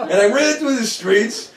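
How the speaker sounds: ordinary talk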